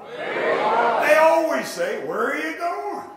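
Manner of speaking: loud, high and slow, stretched out. Shown as a demonstration. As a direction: belt it as the startled seller. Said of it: "Come on, louder".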